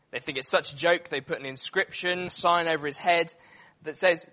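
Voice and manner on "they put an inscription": normal talking voice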